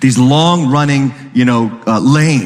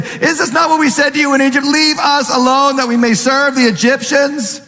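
mock complaint; 9 semitones higher than usual. They said.

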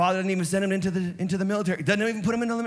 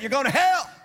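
preached shout; 7 semitones higher than usual. Real, not acted. Preacher warning the crowd about hell, shouting. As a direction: thunder the warning at the congregation.

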